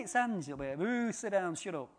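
normal talking voice

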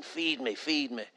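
a small begging voice, barely voiced; softly imitated child begging to be fed; mock child pleading. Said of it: "I was saying".

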